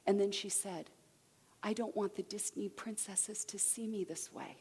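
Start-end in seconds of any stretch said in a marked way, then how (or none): 1.6-4.6 put-on soft, hushed quote of a hurting child; understated, soft retold sadness; sad little girl. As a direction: say it quietly, imitating her hurt. Quotes crying five-year-old daughter.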